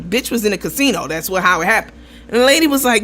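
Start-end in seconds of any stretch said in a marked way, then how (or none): none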